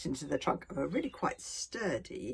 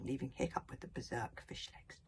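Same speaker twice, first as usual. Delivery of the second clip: ordinary delivery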